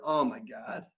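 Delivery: usual speaking voice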